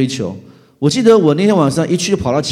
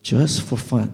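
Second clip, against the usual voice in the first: lightly voiced calm inner voice; inner voice of God; 2 semitones lower, 5 dB quieter, slower than usual. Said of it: "那声音来跟我说". (轻柔低沉的声音)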